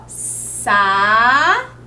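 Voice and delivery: "Sapo" split as sa-po while writing it; sounded out. Shown as a demonstration. Teacher writing "Sapo" on the slide for children, sounding it out. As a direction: drag "Sapo" out syllable by syllable. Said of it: "Então, vamos escrever aqui, ó".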